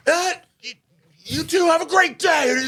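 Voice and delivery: stammer, then yell slowly in a high voice; flustered comeback. Retold or imitated. Furious husband to the departing driver, imitated flustered yell. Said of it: "And he goes".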